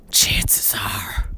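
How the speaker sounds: low, ominous stage whisper; imitating a husband's morose whisper; side-of-mouth whisper. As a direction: hiss it gravely from the corner of the mouth. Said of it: "With side of the mouth".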